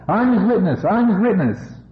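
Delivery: a chorus of soldiers shouting their claim, hammed up a little; roaring army. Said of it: "from Mara's army came a roar".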